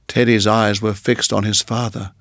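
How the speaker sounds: plain reading voice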